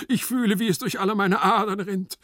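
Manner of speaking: high, impassioned outburst; melodramatic cry. Acted. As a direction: declaim in desperate passion. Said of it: "schrie der Marchese noch immer".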